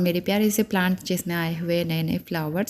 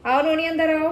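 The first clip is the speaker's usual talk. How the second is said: higher, louder call to a little one; 8 semitones higher, 5 dB louder than usual. Real, not acted. माँ बच्चे को अंदर बुलाती है. (बच्चे को ऊँची आवाज़ में पुकारते हुए)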